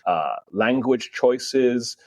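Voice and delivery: ordinary delivery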